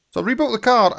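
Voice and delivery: bursting with excitement; excited. Real, not acted. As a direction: sell it like big news. Retake, more hyped second time.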